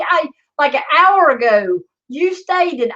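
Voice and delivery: ordinary talk, nothing marked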